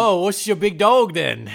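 hammed-up Cockney at a wolf. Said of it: "Who's Cockney".

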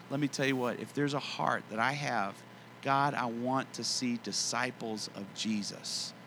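normal talking voice

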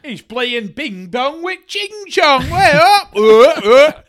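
high, loud comedian impression; Bernard Manning. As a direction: bellow it like an old club comic. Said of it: "That's my Bernard Manning impression".

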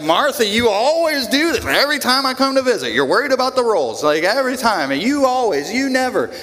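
fast, nagging reproach; mock harsh scolding. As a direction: lightly scold her for always fussing. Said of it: "Like kind of harsh".

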